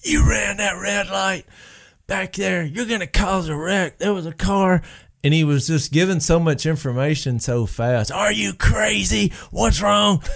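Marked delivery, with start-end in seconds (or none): 0.0-4.8 higher, hushed imitation of a scolding; half-whispered telling-off from a trooper; retold angry cop lecture, pitched up; mock angry cop. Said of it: "he just lit into me". 8.1-10.2 higher, slower mock shout; imitating a cop shouting at a driver. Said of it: "he just lit into me".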